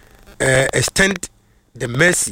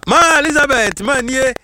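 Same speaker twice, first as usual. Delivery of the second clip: excited call-out; 10 semitones higher, 5 dB louder than usual. Real, not acted. Joyful shout-out to a listener.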